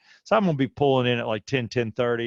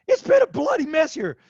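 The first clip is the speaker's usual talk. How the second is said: high, loud exclamation; frantic outburst; 13 semitones higher, 5 dB louder than usual. Retold or imitated. Husband telling wife about the bloody bathtub, frantic high outburst. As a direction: cry it out to someone just arriving.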